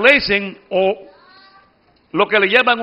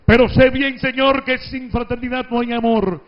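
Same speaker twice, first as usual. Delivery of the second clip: hyperbolic reading; 6 semitones higher, 5 dB louder than usual. Shown as a demonstration. Teacher showing students the booming political-speech reading, hyperbolic style. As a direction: thunder it out like a campaign speech. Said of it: "lo voy a leer entonces en forma hiperbólica".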